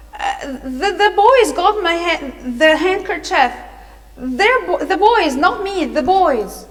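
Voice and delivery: high, loud, stumbling protest; protesting cry. A narrator's reading. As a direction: blurt it out in pieces, pleading innocence, high and loud. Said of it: "started to say or to cry".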